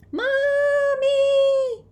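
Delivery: very high, long-held scream. A narrator's reading. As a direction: scream desperately for your mother. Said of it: "Teresa is still screaming".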